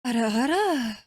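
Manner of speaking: playful put-on anime tease; teasing ara ara. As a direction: croon it with a swooping rise and fall. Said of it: "you want an ara ara?".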